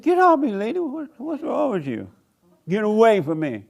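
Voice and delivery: high, loud alarmed shout; startled shout. Retold or imitated. Preacher fending off the stalker in his lot, a high alarmed shout. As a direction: shove her off with your voice, alarmed. Woman jumps him in parking lot.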